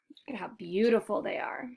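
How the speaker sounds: hushed aside to the listener; whisper. Real, not acted. Reader whispering to a child beside her. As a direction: softly point out the fish.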